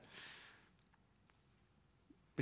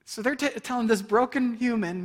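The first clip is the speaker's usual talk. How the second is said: usual speaking voice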